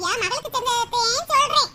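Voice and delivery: ordinary talk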